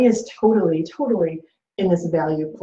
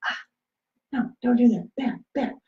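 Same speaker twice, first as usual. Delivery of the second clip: higher-pitched, play-acted objection, softening at the end; mock knee-jerk protest; 5 semitones higher, 3 dB quieter than usual. Partner reacting to information shared without consent, mock protest. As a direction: act out a quick defensive reaction.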